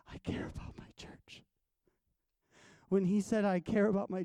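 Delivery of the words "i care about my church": whispered, breathy and hushed. Retold or imitated. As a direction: hush it to a whisper as God's confiding word. God's words, whispered.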